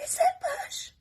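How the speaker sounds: tiny, very high inner voice; drawn-out high-pitched glee; high giggly squeak. Retold or imitated. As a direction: squeal it slowly like a tickled kid. Second take, squealed inner thought.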